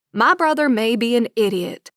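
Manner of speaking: usual speaking voice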